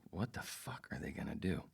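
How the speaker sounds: breathy stage whisper, disbelieving; whispered thought. Retold or imitated. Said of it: "I was like".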